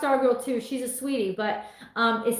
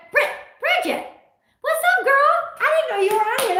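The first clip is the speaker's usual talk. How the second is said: excited greeting; 14 semitones higher, 6 dB louder, faster than usual. Real, not acted. Surprised to see sister-in-law online.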